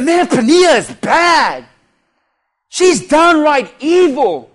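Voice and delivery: slow, emphatic indignant outburst; mock outrage. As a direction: play up the reader's anger at the villain. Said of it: "I was mad at Pania".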